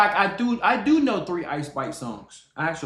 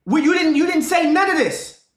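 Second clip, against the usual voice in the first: shouting her down; 9 semitones higher, 6 dB louder than usual. Real, not acted. Streamer shouting at a girl caught lying in the clip. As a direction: yell it like you caught a liar.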